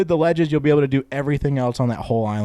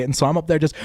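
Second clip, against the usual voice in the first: ordinary talk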